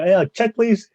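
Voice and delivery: mock-urgent flag-down of the waiter; calling for the check. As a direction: pitch climbing as you call out.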